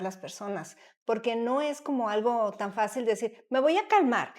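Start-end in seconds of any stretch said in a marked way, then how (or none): 3.5-4.3 a lightly acted, slightly louder self-promise; mock resolve. Host mocking the idea that calming down is easy, ironic. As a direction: play at telling yourself to calm down.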